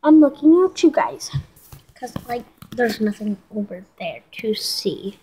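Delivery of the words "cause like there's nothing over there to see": soft unvoiced whisper. Real, not acted. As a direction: whisper it under your breath. Whispering aside to camera.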